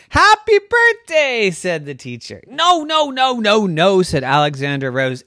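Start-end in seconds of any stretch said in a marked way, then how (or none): 0.1-1.5 high, sing-song happy birthday; cheerful teacher greeting. A narrator's reading. 2.5-4.0 put-on a light child's no, no, no; child protesting. A narrator's reading. Child refuses loudly.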